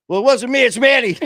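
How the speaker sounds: high, loud mock-defensive voice, passing the blame; defensive husband. Husband blaming friend Manny for his nerves, high and loud mock defence. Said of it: "And then he goes".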